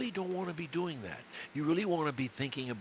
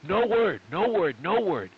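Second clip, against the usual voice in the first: loud, firm no; emphatic no; 3 semitones higher, 7 dB louder than usual. Real, not acted. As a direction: cut him off firmly. Coach stopping the student.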